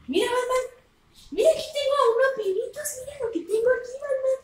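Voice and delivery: high coaxing voice for the dog. Retold or imitated. To her dog Batman, coaxing him, high and sweet.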